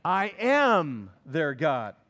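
"I am their God" very slow, loud and weighty; stress on am. Shown as a demonstration. Preacher correcting the tense for the congregation, slow and loud. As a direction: let "am" ring as the emphatic present. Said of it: "No, he says what?".

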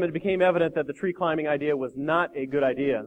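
ordinary delivery

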